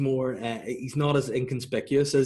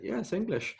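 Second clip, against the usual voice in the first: posh Eubank voice; 9 semitones higher, 6 dB quieter than usual. Retold or imitated. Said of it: "he's like".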